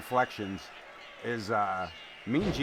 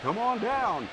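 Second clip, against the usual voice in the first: high, loud game show call; game show announcer; 10 semitones higher, 4 dB louder, slower than usual. Retold or imitated. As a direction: belt it like a TV host calling a contestant. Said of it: "like the game show announcers".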